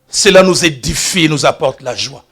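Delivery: normal talking voice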